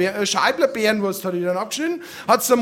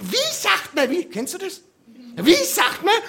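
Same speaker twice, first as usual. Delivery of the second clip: nagging mother; 5 semitones higher than usual. Retold or imitated. Mother prompts thanks.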